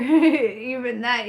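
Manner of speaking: ordinary talk, nothing marked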